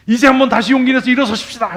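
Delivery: high, loud rousing call to stand; rally the crowd like a revival leader; imitating a fervent altar call; mock revival preacher.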